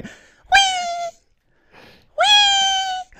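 very high sustained "wee"; imitated high-pitched resonant squeal; vibrating squeal. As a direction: ring out at a spider-scream frequency. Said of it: "starts vibrating 'cause that's his harmonic frequency".

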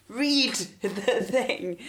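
slow exasperated laugh; drawn-out "read the thing", half laughing. Retold or imitated. Exasperated retort to dater.

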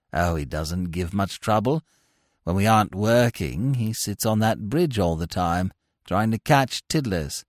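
lightly voiced long-suffering suitor; weary lovesick Bingo. A narrator's reading. Bingo voiced throughout scene.

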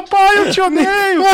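put-on high, slow, loud kid wail; angry daughter. Imitating his daughter yelling at dad on the coaster. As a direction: wail it like a furious little girl.

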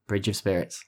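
ordinary delivery

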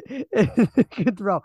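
laughing as the words come out. Real, not acted. Reading a friend's mocking text aloud, laughing.

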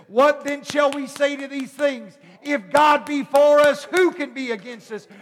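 loud, high preached scripture building up; fiery preaching. Acted. Pastor preaches Romans to the congregation, loud and building. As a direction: preach it out with rising fire.

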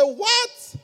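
high, rising disbelief; astonished. Retold or imitated. Reader hearing Tom Peters endorse the book, astonished. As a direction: shoot up high in amazement.

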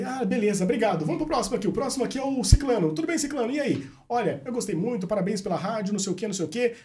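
mock radio host greeting listeners; imitated radio host. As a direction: play the cheerful radio announcer taking calls. Retold radio host greeting callers.

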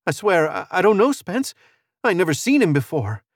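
high, flustered woman's denial; frightened, trailing off. A narrator's reading. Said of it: "Her voice trailed off".

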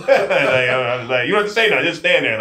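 loud, high, laughing come on man; cracking up at a complaining recruit; laughing disbelief. Real, not acted. Host laughing at a complaining recruit, loud and high.

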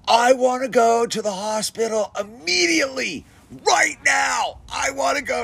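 put-on high, wailing kid demand; bawling kid. Crashed kid begging the rider, high wailing whine. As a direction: whine to go to the hospital. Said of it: "he's bawling".

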